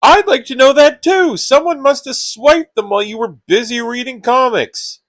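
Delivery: high, loud retort, easing in volume; angry retort. Acted. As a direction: throw the blame straight back. Quarrel over stolen gifts.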